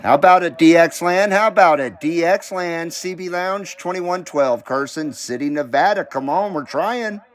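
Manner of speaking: CB radio call. Real, not acted. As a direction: call out over the CB, hailing anyone listening.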